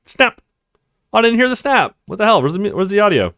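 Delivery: ordinary talk, nothing marked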